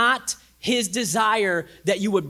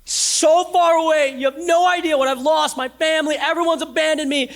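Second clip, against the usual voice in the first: a broken person pleading about their loss, higher and louder; despairing plea; 10 semitones higher, 7 dB louder than usual. Retold or imitated. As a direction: cry out your loss. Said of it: "they say".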